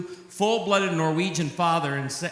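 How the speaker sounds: normal talking voice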